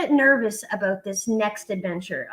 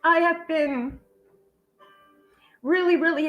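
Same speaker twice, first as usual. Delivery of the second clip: choking up for real, higher voice; emotional; 4 semitones higher than usual. Real, not acted. Host needing a second on the livestream, emotional. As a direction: pause to collect yourself, voice raised with emotion. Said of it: "I get emotional".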